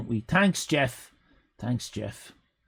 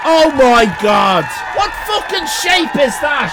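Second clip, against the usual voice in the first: loud shocked outburst; 7 semitones higher, 4 dB louder than usual. Real, not acted. Streamer shouting at a weird card shape in shock. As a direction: shout it in outraged disbelief.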